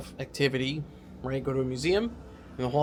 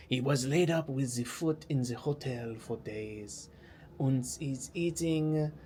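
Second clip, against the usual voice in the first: slow, badly faked French accent; mock-dramatic French play narration, drawn out; slower than usual. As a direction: ham up a French accent that slips into German. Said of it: "I can't do French accent".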